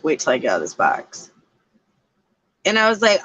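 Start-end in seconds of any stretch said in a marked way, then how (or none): 0.0-1.3 low, menacing robot threat; a hint of a sinister voice; mock menacing AI. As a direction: growl it like a trapped demon. AI threatens speaker.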